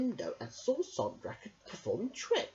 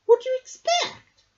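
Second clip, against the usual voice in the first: put-on high, loud old woman chuckle; laughing Myrtle; 13 dB louder than usual. A narrator's reading. Myrtle teasing Merrick, high and amused. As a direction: tease him fondly.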